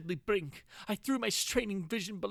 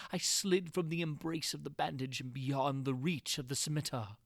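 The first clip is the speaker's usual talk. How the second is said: plain reading voice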